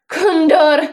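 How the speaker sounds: loud, high mocking exclamation; incredulous bear guffawing on the name; bursting into laughter. A narrator's reading. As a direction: blurt it out in derisive mirth. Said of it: "zase propukl v smích".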